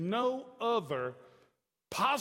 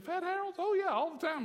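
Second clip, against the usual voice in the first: much higher-pitched, blithe mama voice; imitated mother; 11 semitones higher than usual. Son imitating his mother admitting she danced, high voice. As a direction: pipe up high, casually owning up. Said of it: "she looked at me, she said".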